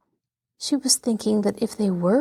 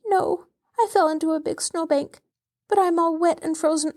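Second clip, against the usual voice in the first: put-on higher tearful child, voice wet with crying; 7 semitones higher than usual. A narrator's reading. Cold wet child answering from the pit, crying. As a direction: cry through the words. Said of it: "The child was crying, sobbing".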